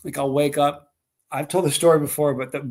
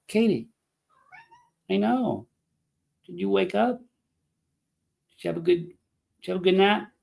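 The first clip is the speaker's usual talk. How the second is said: higher-pitched, slow-paced chat to a pet; pet talk; 6 semitones higher than usual. Real, not acted. Owner asking the cat about her nap, cooing pet talk. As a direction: coo questions at a sleepy cat.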